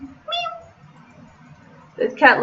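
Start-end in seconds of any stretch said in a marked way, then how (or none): 0.0-0.7 high, soft imitated meow; a small mewing cat sound. Player mimics the staring cat with a meow.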